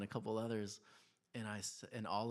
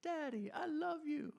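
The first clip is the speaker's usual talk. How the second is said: put-on high, childlike sweet voice; squeaky little boy saying I love you; little son's voice. Son gushing to his daddy, high childlike voice. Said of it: "he's like".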